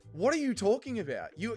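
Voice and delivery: ordinary delivery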